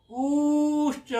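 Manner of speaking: "ऊश्च" with the ऊ drawn out very long and slow; prolonged vowel. Shown as a demonstration. Teacher chanting the pluta length for the class. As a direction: stretch the ऊ of "ऊश्च" far past a normal long vowel. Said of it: "सूत्र की वृत्ति कह रही है".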